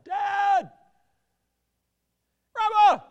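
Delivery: desperate high shout into the woods; yelling. Retold or imitated. Lost boy yelling for his dad in the woods. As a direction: call out loud for a lost family. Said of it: "And I yelled".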